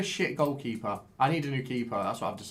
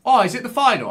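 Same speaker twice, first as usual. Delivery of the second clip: surprised; 9 semitones higher, 11 dB louder, faster than usual. Real, not acted. Suddenly noticing darts final.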